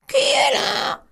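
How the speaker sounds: the wolf squeaks like grandmother; wolf in bed faking the old woman; small voice. Acted. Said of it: "en parlant d'une petite voix".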